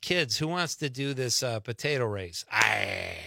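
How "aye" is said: low gruff pirate "Aye". Acted. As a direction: growl it like an old sea dog. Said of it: "Talk like a pirate".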